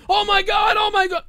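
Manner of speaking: high mock-panicked yelps; hammed-up frantic outcry; Kurt Angle. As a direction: squeal it in fake alarm. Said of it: "it was all like".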